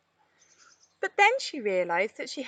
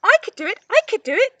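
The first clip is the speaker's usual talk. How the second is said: high, loud, eager monkey chatter; 13 semitones higher, 10 dB louder, faster than usual. A narrator's reading. Monty the monkey eagerly volunteering to friends, high excited chatter. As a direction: squeak it out, begging to be chosen. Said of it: "chattered Monty".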